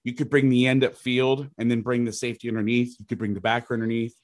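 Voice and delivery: ordinary talk, nothing marked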